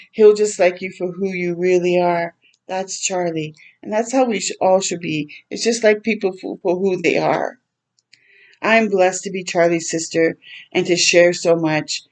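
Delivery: ordinary delivery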